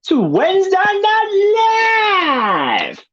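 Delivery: high sung-out host intro with a leap up on Night; mock announcer swooping up on Night; Tom Bruno impression. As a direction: stretch Night way up like a showman, then drop. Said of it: "as Tom Bruno would say".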